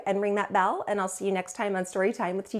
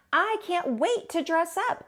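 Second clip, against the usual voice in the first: higher, excited little voice; T-Bone looking forward to costumes, eager and higher; eager kid voice; 10 semitones higher, slower than usual. Acted. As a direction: lift it up with excitement. T-Bone voiced in kids' story.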